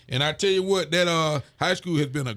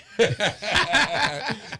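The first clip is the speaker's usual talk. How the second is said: laughs; 5 semitones higher than usual. Real, not acted. Laughing at a viewer's comment.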